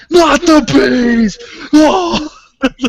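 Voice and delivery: full melodramatic bee panic; hammed-up horror-movie wail; Wicker Man scream. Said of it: "You gotta go, uh, full, uh, Wicker Man".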